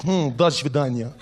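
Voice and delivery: put-on menacing Russian accent; low, slow Russian menace. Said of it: "that thing when they speak".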